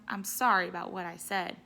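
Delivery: plain reading voice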